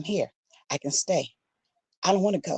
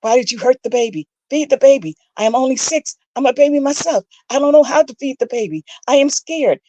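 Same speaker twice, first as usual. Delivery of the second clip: rising panic; 7 semitones higher, 6 dB louder than usual. Acted. Panicked child, escalating orders.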